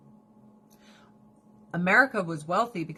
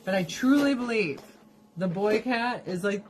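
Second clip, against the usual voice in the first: ordinary talk, nothing marked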